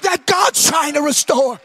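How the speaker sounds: shouted, sung-out sermon peak over music; preaching at full force. Real, not acted. Preacher to his congregation, shouted and half-sung. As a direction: ring it out like a revival preacher.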